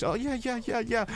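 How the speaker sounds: high, soft nervous yeahs; mock nervous yeah. Narrator mimicking the caught thief, high and flustered. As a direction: squeak out flustered agreement.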